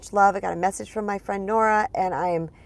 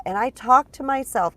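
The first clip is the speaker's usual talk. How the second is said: ordinary talk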